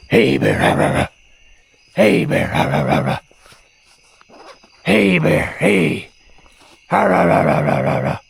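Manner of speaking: loud growling yells; scaring off a bear. Real, not acted. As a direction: roar rah rah at a bear.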